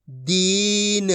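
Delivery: दीन very slowly, the ee held; drawn-out long ee. Shown as a demonstration. Teacher models the joined word, long ee drawn out. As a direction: stretch the middle vowel of दीन. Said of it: "खींच के पढ़ेंगे".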